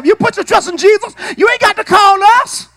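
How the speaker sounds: high, loud, near-crying plea; God's call to trust, preached with a catch in the voice; voicing God. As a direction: cry it out as God's own words. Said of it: "Y'all don't hear him say".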